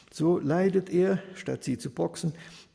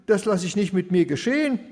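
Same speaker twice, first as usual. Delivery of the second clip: higher, louder, outraged protest; the widow refusing him, pitch climbing; shrill-rising indignant widow, louder; 6 semitones higher, 7 dB louder than usual. Acted. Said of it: "spricht sie".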